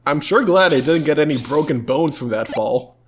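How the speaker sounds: ordinary talk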